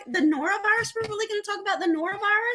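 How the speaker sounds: disbelieving retold reaction; mock disbelief. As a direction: ask it like you can't believe it.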